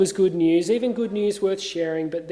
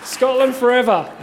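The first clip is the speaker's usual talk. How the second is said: put-on raised rallying cry in a Scottish accent; William Wallace; 4 semitones higher, 4 dB louder than usual. Minister capping his battle speech for Scotland, Scottish-accented cheer. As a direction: call it out high, attempting Scottish. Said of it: "the end almost of my Scottish accents".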